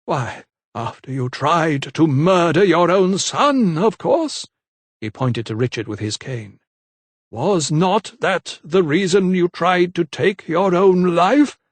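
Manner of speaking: a hint of cruel sweetness; accusing vicar. A narrator's reading. Vicar accusing the lady, slow and sly.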